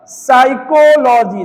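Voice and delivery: साइकोलॉजी said slowly with no p, starting on s; slow, careful साइकोलॉजी with the p left silent. Shown as a demonstration. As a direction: say साइकोलॉजी as "saikolaji", dropping the p. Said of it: "पी को नहीं पढ़ रहे हैं".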